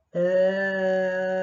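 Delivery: long held even vowel sound; sustained vowel demo. Shown as a demonstration. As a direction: hold the vowel steady and loud. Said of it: "vamos a hacer ese mismo sonido".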